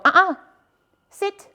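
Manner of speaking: a quick, sharp guiding uh-uh; correction marker. Shown as a demonstration. Trainer correcting the dog with a leash bump. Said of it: "I'm gonna go".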